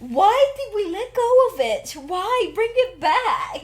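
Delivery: high, loud mock lament; impassioned plea. Reacting to old heartbreak poetry, playfully impassioned plea.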